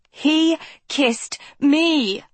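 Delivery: high, slow desperate scream. A narrator's reading. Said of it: "Philip screamed".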